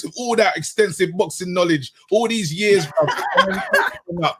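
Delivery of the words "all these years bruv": laughing through the words, pitch jumping up on bruv; laughing gloat. Real, not acted. Host gloating at co-host Travis's wrong prediction, laughing. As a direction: gloat while cracking up. Gloating over rival's face.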